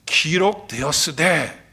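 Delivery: rebuking the devil. Acted. Preacher quotes Jesus repelling the devil in the wilderness, slow solemn citation. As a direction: pronounce it slowly and deliberately, with weight. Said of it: "마귀를 물리칠 때 어떻게 물리치셨죠".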